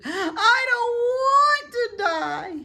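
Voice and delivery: very high, loud, slow wailing sob; crying moan. A narrator's reading. Wilbur bursts into tears and wails to Charlotte. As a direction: wail it through tears. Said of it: "he moaned".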